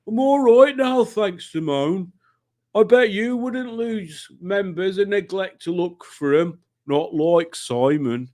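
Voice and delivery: put-on high, slow comic accent; [name]'s put-on accent. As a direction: have another go at the imitation accent. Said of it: "reading things in [name]'s accent".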